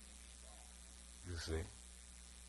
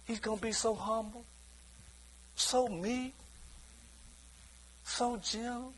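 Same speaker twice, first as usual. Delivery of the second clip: softer, higher, soft words for meekness; gentle softening; 5 semitones higher, 8 dB quieter than usual. Shown as a demonstration. Preacher tells the church how humble God is, softly. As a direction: let each word grow softer and gentler. Describing God's humble manner.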